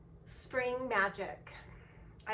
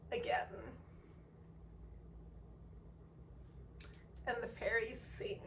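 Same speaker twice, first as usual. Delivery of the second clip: real emotion welling up, voice fading at the end; close to tears. Real, not acted. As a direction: hold back tears through the lines. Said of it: "so that I don't cry".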